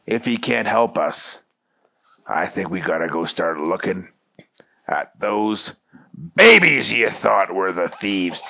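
low, deliberate boss voice; police chief. Acted. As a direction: drop the pitch and ponder it. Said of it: "The police chief thought".

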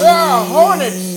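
high, loud, drawn-out cry; loud slow cry. Acted. Agent reacts to hornets.

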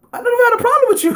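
fast, very high-pitched protest; mock innocence. Retelling his answer when asked if they're good, mock innocent. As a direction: squeak out a mock denial on the edge of laughter. Acts out his reply, breaks into laughter.

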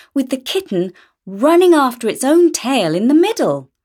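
usual speaking voice

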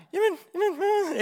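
high, faltering scaredy-cat voice; hammed-up nervous protest; acted timid hesitation. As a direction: stammer it out, too scared to jump. Acting hesitant person at chasm again.

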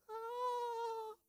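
high, sharp mock martial-arts yell; a movie fighter's battle cry, hammed up; kung fu cry. Preacher imitating Jesus as a kung fu hero, mock high yell. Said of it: "Jesus like".

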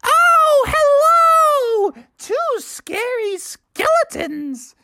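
put-on high, slow sing-song old lady voice; squeaky cheerful little old lady; sweet old lady. A narrator's reading. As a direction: greet them like a doting grandma. Old lady greets trick-or-treaters.

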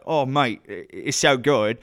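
put-on drawn-out, matey South London accent. Actor larking about for the director, South London accent. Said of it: "Can you do that accent".

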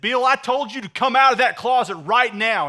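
acted fed-up wife, loud and high; outraged yell. Wife losing patience with her husband in the closet, outraged yell. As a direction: yell it as a furious last order. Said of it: "she's outraged. She's getting mad".

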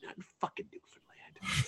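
imitated harsh whisper; whispered mock reprimand; embarrassed scolding. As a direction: whisper it like a mortified friend. Embarrassed friend scolds diner in restaurant.

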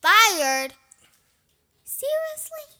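loud, high shocked disbelief; surprised. Real, not acted. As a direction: gasp it out in shock. Told they're fired.

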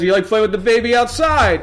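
a lightly voiced wise guy; goomba voice. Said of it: "he goes".

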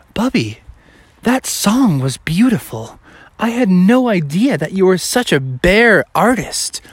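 mildly hammed-up admiration; admiring camper. Moved campers praise the singer.

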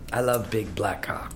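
low, quiet sultry line; deep voice. Retold or imitated. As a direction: drop into a deep, sexy voice. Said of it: "Listen to how she says that with that deep voice".

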